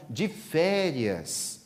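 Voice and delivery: very slow, stretched de férias; drawn-out vacation. Acted. Friend savouring his vacation to Divaldo, very slow drawl.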